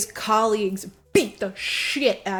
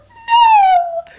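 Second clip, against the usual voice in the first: very high cartoon squeal, loud; mock squealed no; 14 dB louder than usual. Retelling Montag's refusal, hammed-up high squeak. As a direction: yelp it in shrill mock despair. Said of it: "he's like".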